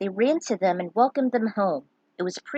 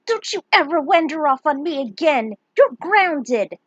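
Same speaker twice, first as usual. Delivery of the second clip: louder, higher mock-stern telling off; stern scolding; 7 semitones higher, 7 dB louder than usual. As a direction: scold him like a cross mother. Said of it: "Her face grew stern".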